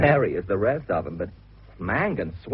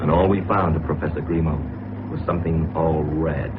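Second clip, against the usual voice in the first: grim story narration; 8 semitones lower than usual. A narrator's reading. Narrator recounting the dying professor, low and ominous. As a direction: tell the grim ending gravely and deliberately.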